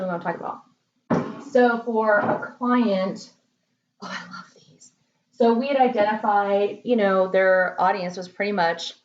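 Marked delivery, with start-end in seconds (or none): 4.0-4.9 delighted little whisper to herself. Real, not acted. Marketer showing client mugs, whispering with delight. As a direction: breathe it out, barely voiced. Picking up favorite sample mugs.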